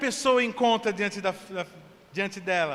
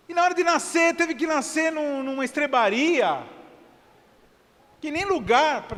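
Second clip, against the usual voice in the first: puzzled questioner; 7 semitones higher than usual. Pretend. Questioner asking Jesus why.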